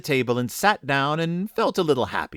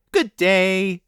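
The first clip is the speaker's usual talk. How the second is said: high, cheery greeting; cheerful; 7 semitones higher, 4 dB louder than usual. A narrator's reading. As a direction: sing out a bright hello. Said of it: "the same cheerful voice".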